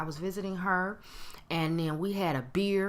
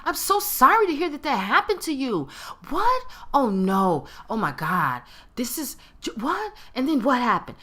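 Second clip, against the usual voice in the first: mock sympathy bubbling with excitement, pitched up; high, loud, eager-for-the-dirt reactions; excited hot-gossip tone; 5 semitones higher, 4 dB louder than usual. Friend hungry for gossip hears bad news, excited. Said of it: "that is the tone of someone ... got them some hot gossip. They're excited".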